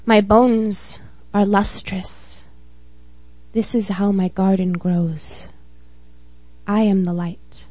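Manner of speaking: measured spoken-word poem reading; steady, earnest poetry reading; poem recital. Acted. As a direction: read it like a poem at an open mic.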